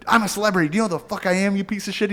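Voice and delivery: high mock-outraged old star demanding recognition; Chevy Chase raging. Chevy Chase yelling at the kid in traffic, high and indignant. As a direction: screech out your own importance. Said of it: "He's like".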